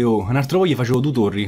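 normal talking voice

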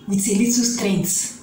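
ordinary talk

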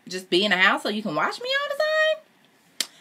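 high-pitched disbelieving question; incredulous. Real, not acted. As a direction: climb high at the end in disbelief. Incredulous reaction to the story's demand.

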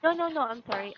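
real embarrassed, emphatic apology; flustered apology. Real, not acted. Teacher denying she wants to finish early, flustered. Apologises after student's laughing question.

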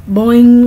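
loud comic boing sound effect; cartoon boing. Shown as a demonstration. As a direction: make a cartoon boing noise. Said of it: "like a big old question mark".

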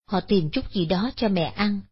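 ordinary delivery, nothing marked

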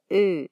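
Ö as an E said through lips rounded for O; rounded front vowel Ö; German Ö. Shown as a demonstration. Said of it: "Una forma fácil de pronunciarla".

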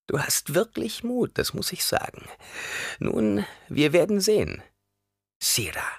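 put-on high, grudgingly impressed old woman; old crone voice. A narrator's reading. Old woman grudgingly praising Paul's courage, high voice. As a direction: concede his courage with an edge.

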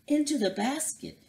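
high, hurried cow voice; urgent Clorinda. A narrator's reading. Clorinda rushes friends into the basket, high and urgent. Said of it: "Clorinda said".

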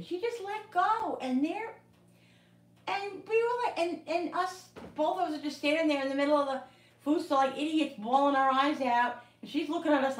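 high, loud, emotional outpouring; overwhelmed rush. Real, not acted. Mother recalling daughter's first steps, high and overcome. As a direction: voice lifting as the joy floods back.

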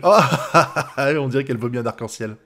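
ordinary talk, nothing marked

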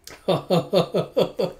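high, rapid burst of laughs; laughter. Real, not acted. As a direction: laugh in a quick run of ha-has. Reader laughs at the story.

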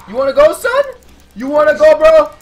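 hammed-up taunt daring a fight; fake tough guy swagger. Taunting an enemy in game.

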